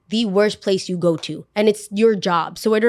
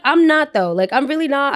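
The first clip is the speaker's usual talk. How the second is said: louder, higher insistence; genuinely protesting, raised voice; emphatic protest; 6 semitones higher, 4 dB louder than usual. Real, not acted. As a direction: push back hard, really mean it. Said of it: "insisting she isn't crazy".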